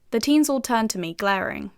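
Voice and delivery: ordinary delivery, nothing marked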